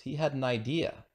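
ordinary delivery, nothing marked

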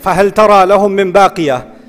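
very fast recited Arabic line; quick quoted recitation; Quran recitation. Acted. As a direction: rattle off the verse in a chant. Quoted ayah.

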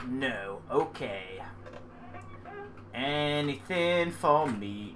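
ordinary delivery

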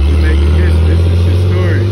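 deep, loud mock-solemn declaration; mock proclamation. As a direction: proclaim it like a grand historic moment. Proud moment at ancestral road.

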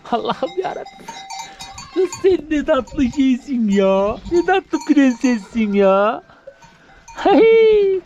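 cooing to the newborn goat; high doting coo. Real, not acted. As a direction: gush over the little goat. Doting over the kid.

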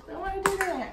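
ordinary talk, nothing marked

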